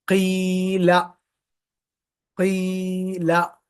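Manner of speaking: क़ीला slowly with the long ई held and the lips unrounded; unrounded, stretched. Shown as a demonstration. Teacher showing unrounded lips on the long vowel. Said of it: "गोल नहीं कर रहे होंठ".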